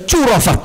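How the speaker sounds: "curafat" with an initial "ch" sound; joke-read "curafat" by old spelling, starting "choo". Shown as a demonstration. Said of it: "ejaan zaman dulu".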